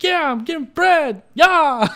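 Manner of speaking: put-on high, loud, eager child voice; innocent little kid. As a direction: exaggerate a naive kid's slow, excited yes. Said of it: "Being a little freaking innocent little kid".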